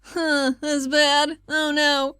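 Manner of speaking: ordinary talk, nothing marked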